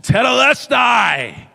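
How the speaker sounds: drawn-out, high, loud cry of victory; preacher acting out the cry from the cross, loud triumphant yell. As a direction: shout it out slow like a final victory cry.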